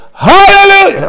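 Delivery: sudden high, loud hallelujah; praise shout. Shown as a demonstration. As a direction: belt it in the devil's face.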